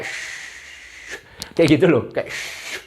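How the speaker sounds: long hushed "sh" each time; sh sound. Shown as a demonstration. Teacher showing viewers the sound, hushing sh. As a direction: stretch each "sh" out. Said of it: "S H gitu loh".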